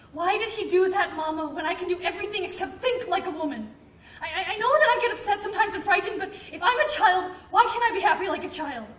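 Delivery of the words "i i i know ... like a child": rising, louder and higher distress; pleading outburst, voice climbing; upset pleading. Acted. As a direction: push her frustration out to Mama. Daughter pleads upset with mother.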